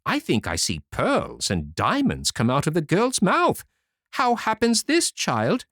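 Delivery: mother astonished at pearls; curious mother. Acted. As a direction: press the girl for answers. Mother questions her daughter.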